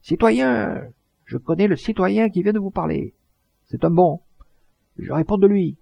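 thundering voice. Acted. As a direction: roar it like a man calming a riot. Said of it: "cria d'une voix de tonnerre".

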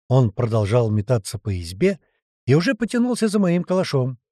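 plain reading voice

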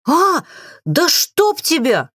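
high, drawn-out curse; wild yell. A narrator's reading. Witch cursing the crawling chest, high wild yell. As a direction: cry out in high-pitched alarm. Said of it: "дико заорала".

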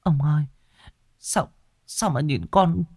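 high, hushed and shaky; cling to husband, scared; frightened wife, high and soft; frightened whisper. A narrator's reading. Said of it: "run run bấu tay vào vai chồng".

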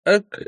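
put-on drunken hiccup. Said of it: "юный Виконт пьяно икнул".

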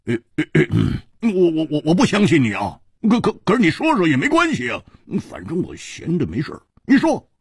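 flustered stammer, tripping over repeated words; agitated stammering man, jumping in pitch; blurting reluctantly. A narrator's reading. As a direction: grudgingly give in, stuttering and rushing. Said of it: "终于还是忍不住说".

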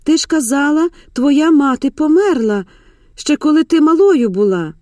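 high, loud accusation of mother; desperate outcry. A narrator's reading. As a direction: protest desperately, pitch held up. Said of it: "відчайдушно вигукнула Мар'яна".

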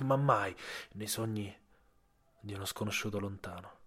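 plain reading voice

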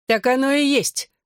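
high-pitched spiteful jab; venomous hiss. A narrator's reading. Bella jeers as the girl falters, high and venomous. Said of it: "прошипела она".

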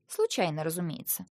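deadpan ironic afterthought; wry aside. A narrator's reading. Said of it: "ironic aside". (иронично)

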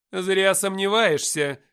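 high, loud and slow, full of authority; commanding voice. Acted. Gabriel silencing Nikita's doubts, commanding and slow. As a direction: lay it down as an order, slowly. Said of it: "властным голосом заявила".

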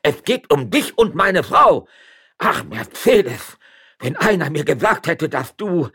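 loud, high, drawn-out tirade; jealous shouting. Acted. Magistrate to his wife and rival, shouting jealously. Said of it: "schrie der Stadtrichter, den wiederum seine Eifersucht packte".

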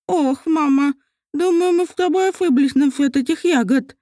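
slurring with a swollen tongue. Acted. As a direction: speak around a swollen tongue. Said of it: "с трудом выговаривала слова".

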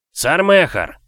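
high, loud shouted greeting. Acted. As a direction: call his name out across the yard. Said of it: "крикнул он".

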